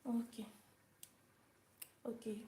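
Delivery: ordinary delivery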